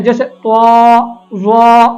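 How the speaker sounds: त्वा slow with a w glide; wrong added w. Shown as a demonstration. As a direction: round into w after the t. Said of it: "वाव की आवाज़ को add किया जाता है". (त्वा ज़ा में वाव की आवाज़)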